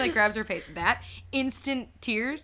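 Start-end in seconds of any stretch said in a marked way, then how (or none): none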